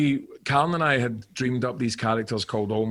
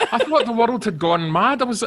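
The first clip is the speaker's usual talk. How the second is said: ordinary talk, nothing marked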